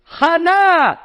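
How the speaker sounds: high, drawn-out mock scream; retold scream. Mother calls the baby's name from the ladies' section, high scream. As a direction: holler the name across the synagogue. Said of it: "And she screams out".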